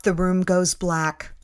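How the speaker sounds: plain reading voice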